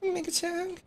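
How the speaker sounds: mock the high public persona. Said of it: "The ultimate fucking troll, [name]".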